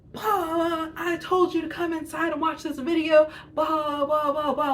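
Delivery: high, loud mock yell of the angry gate woman; retold yelling. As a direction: imitate her ranting at you, high and loud. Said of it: "just running her mouth and yelling at me".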